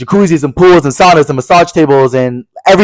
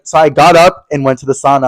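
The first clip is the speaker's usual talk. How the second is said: ordinary talk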